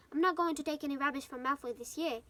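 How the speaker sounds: usual speaking voice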